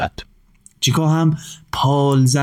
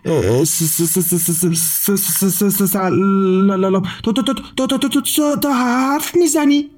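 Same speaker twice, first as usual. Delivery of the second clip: put-on high stammering child, stunned; astonished boy stammering at a talking bird; amazed stutter; 7 semitones higher than usual. A narrator's reading. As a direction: stutter in disbelief, climbing higher. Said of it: "با تعجب و لکنت گفت".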